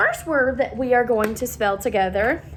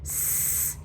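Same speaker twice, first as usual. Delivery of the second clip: whispered letter; 12 dB quieter than usual. Shown as a demonstration. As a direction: whisper the S sound. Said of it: "we should have our S".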